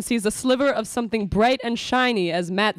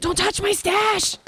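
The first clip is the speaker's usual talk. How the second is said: high yell; 9 semitones higher, slower than usual. Acted. Sister shouts after brother.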